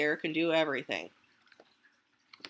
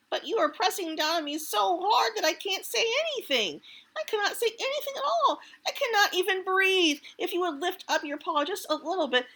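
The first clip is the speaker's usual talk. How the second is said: much higher, pinned squirrel voice; squeezed squirrel; 10 semitones higher than usual. Acted. Trapped squirrel pleads with Bear, high and strained. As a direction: squeak it out as if squashed. Squirrel pretends he can't breathe.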